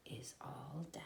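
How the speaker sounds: whispered ending. A narrator's reading. Narrator closes the tale to children in a whisper. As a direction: let the story end in a whisper.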